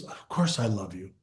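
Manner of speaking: low, soft imitated mother; acted grudging reply. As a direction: grumble it back quietly. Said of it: "She gets angry".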